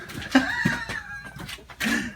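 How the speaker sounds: high shrieking laugh burst. Real, not acted. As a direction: cracks up in a shriek. Scare lands, big reaction.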